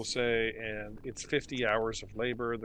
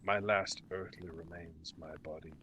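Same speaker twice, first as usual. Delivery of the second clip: low, soft, breathy ghostly lament; a lost spirit grieving over rubble, quietly; mournful ghost; 5 semitones lower, 9 dB quieter than usual. Acted. Ghost laments the fall of Ythryn, low and whispery.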